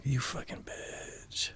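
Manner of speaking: whispered curse under the breath; breathy whisper. Retold or imitated. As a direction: hiss it softly, spent and furious. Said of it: "I'm like".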